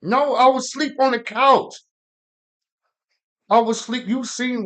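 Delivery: loud and high, defending himself; heated protest. Real, not acted. Man arguing with Jessica about the couch, loud heated protest. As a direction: insist you were asleep.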